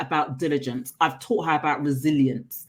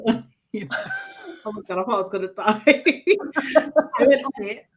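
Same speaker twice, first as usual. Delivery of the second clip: fast, laughing recollection; 6 semitones higher, 3 dB louder, faster than usual. Real, not acted. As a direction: giggle through it. Laughing retelling ride fear.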